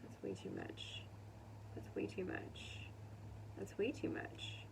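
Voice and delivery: breathy whisper, said three times. Real, not acted. Tarot reader reacting to cards to herself, whispering. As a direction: hush it to yourself over and over.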